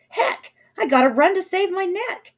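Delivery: high, loud panicked cry; high, loud dash for the door; panicked shout. A narrator's reading. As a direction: yell it as you bolt. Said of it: "She shouted".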